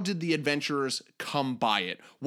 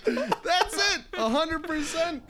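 real laughing words, pitched way up; helpless laughter; slower than usual. Real, not acted. Co-host delighted by the impression, talking through laughter. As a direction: gasp out agreement through high laughter.

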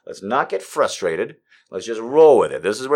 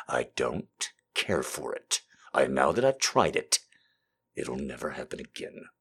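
deadpan reversal; 10 semitones lower, 10 dB quieter than usual. Pretend. Dry joke reversal.

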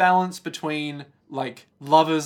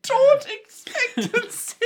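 much higher, slow, amused echo of a phrase; mocking high echo; 4 dB louder, slower than usual. Co-host echoing the florid phrase back, high and mocking. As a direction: squeak the phrase back in disbelief. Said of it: "mocking repeat".

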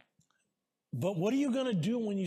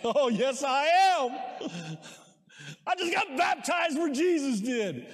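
louder, laughing with joy; real excitement; 4 semitones higher, 4 dB louder than usual. Real, not acted. Pastor to congregation about his Jordan baptism, laughing excitedly. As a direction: laugh and almost shout it, thrilled. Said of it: "I was pumped".

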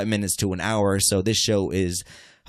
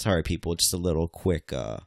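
ordinary delivery

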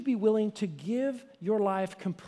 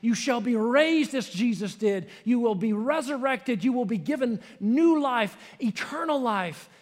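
louder, higher proclaiming; full-force preaching; 4 semitones higher, 6 dB louder than usual. Acted. Pastor proclaiming the resurrection to the congregation, louder and higher. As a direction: declare the resurrection with full power.

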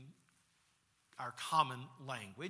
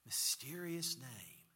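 ordinary talk, nothing marked

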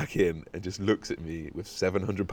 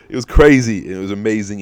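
loud, higher burst of excitement; excited; 3 semitones higher, 8 dB louder than usual. Real, not acted. Telling listeners about the free cash, excited. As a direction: blurt it out, thrilled.

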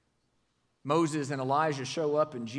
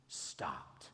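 whispered "stopped", very soft; hushed whisper; 14 semitones lower, 14 dB quieter than usual. Acted. Preacher telling the storm stopping, whispered. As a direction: let the word die to a whisper. Storm stilled, dramatic hush.

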